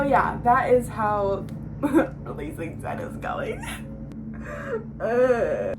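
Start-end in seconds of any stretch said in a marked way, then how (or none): 3.7-5.0 a short, high, real laugh; high burst of laughter. Real, not acted. Reacting to the lawnmower noise with a high laugh.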